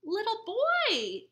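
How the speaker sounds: high, slow, delighted greeting; exclaimed. Acted. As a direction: exclaim happily to the boy. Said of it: "Amu exclaimed".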